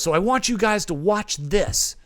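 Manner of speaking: excitedly setting up a screening; Michael Bay. Retold or imitated. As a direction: imitate a director keen to show off. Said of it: "he was just bouncing off the walls".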